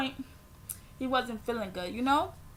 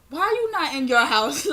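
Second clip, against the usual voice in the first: loud, raised exasperated demand; retold exasperation; 3 semitones higher, 8 dB louder than usual. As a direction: snap back at dad in disbelief. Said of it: "I was so pissed".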